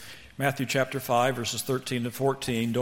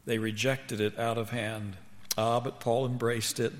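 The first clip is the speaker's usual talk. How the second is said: ordinary talk, nothing marked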